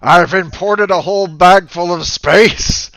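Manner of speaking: booming, slow beast woken from sleep; loud slow growl of a disturbed monster; wolf voice. Acted. GM creature boasts.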